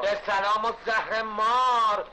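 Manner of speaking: sarcastic retort. Acted. Angry builder answers a worker's greeting, slow and sarcastic. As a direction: drag each word out with scorn.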